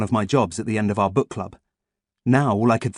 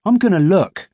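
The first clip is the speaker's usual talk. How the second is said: put-on boy character voice; 8 semitones higher, 6 dB louder than usual. A narrator's reading. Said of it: "pounded up the stairs".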